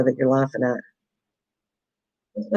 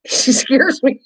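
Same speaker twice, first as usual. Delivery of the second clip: slow words through real laughter; laughing at herself after the scare; 8 semitones higher, slower than usual. Real, not acted. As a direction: giggle through it, half breathless. Laughing at being startled.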